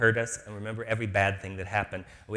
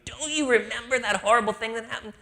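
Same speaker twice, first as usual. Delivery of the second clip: mock reminder; 11 semitones higher, 7 dB louder than usual. People reminding her of old hurts.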